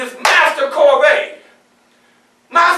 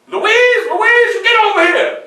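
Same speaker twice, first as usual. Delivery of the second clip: calling out; 8 semitones higher than usual. Retold or imitated. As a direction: yell for her to come over. Master calling a slave in.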